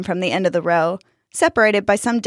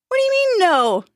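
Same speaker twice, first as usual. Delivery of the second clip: high-pitched, indignant outburst; incredulous shout. A narrator's reading. Jack rounding on Dustin, incredulous shout. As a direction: shoot the pitch up in disbelief. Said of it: "Jack shouted".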